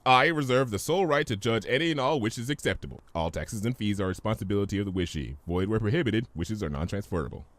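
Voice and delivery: fast legal disclaimer; fine-print rattle. Acted. Discord reciting terms to Fluttershy, fast. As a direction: rattle it off like an ad's small print.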